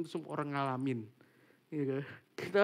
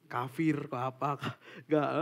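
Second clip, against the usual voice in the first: ordinary talk, nothing marked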